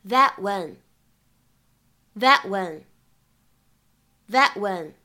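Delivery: "that when" with the t of "that" unreleased, slow; incomplete plosion. Shown as a demonstration. Teacher slowly demonstrates "that when" plosion. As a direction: swallow the t in "that" before "when". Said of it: "我们可以读成".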